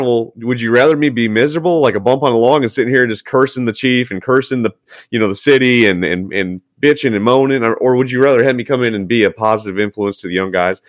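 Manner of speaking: ordinary talk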